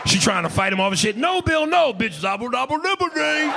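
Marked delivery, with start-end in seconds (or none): 1.2-3.5 high, babbling mock protest; high-pitched comic struggle. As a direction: squeal the refusal into nonsense syllables. Imitating woman fighting him off.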